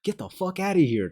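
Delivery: high, loud mock yell of an angry resident. Angry homeowner yelling at door-to-door salesmen. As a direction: yell it like a furious homeowner chasing them off.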